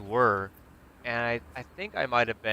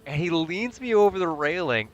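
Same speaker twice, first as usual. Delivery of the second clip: normal talking voice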